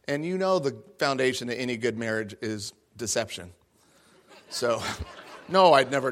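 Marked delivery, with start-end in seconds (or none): none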